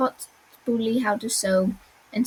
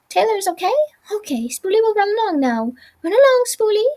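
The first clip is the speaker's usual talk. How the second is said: high, eager little voice; squeaky Spoolie; 10 semitones higher, 4 dB louder, faster than usual. Acted. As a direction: plead kindly in a high squeak.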